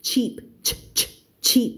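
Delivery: "cheap" with isolated sharp ch bursts first; cut-off CH sound. Shown as a demonstration. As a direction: drill the abrupt "ch" that starts "cheap". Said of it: "มันจะค่อนข้างเสียงตัด". (ออกเสียง ch ตัดสั้นในคำว่า cheap)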